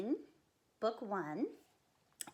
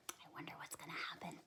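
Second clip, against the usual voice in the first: whisper; 6 semitones lower, 12 dB quieter, much faster than usual. Acted. Teasing suspense to kids.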